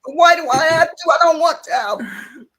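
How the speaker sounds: loud, high mock whine; crying protest. Retelling her protest to the old man, mock crying. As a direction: drag out a tearful, childish complaint. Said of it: "I'm still crying".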